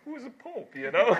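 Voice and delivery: high-pitched, breaking into laughter; amused disbelief. Real, not acted. Preacher joking about meeting the Pope, laughing disbelief. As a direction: let the question tip over into a laugh.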